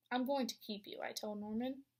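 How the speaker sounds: ordinary delivery, nothing marked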